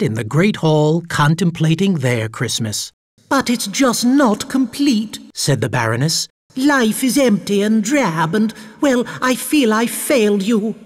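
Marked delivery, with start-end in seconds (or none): 3.3-5.2 Baroness voice. A narrator's reading. 6.6-10.7 Baroness voice. A narrator's reading.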